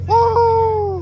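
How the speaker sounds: high whooping cheer; cheering shout. Real, not acted. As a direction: whoop right back. Answering the cheer.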